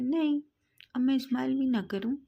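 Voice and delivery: exclaim, can't I even smile; teasing protest to the angry boy; mock-offended protest. A narrator's reading. Man protests being scolded.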